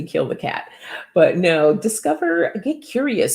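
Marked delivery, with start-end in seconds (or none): 1.7-3.3 excited. Real, not acted. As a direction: jump way up in pitch, eager. Cheering on J R.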